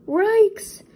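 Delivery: high, loud startled dog yelp; Scooby yelp. A narrator's reading. Scooby diving under the deck chair, yelping in fright. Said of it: "Scooby barked".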